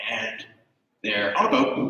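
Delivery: usual speaking voice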